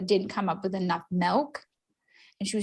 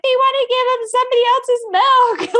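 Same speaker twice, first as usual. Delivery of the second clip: high, loud hammed-up wail; mock distraught protest; 7 dB louder than usual. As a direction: tattle in a pitched-up, outraged whine. Acted tearful protest to doctor.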